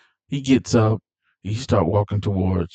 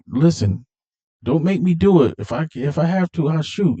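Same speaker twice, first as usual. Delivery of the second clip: tense warning; 7 semitones higher than usual. Retold or imitated. Brian warns Dom at gunpoint.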